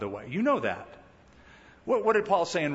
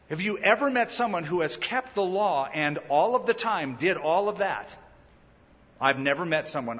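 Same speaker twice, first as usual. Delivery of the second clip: ordinary delivery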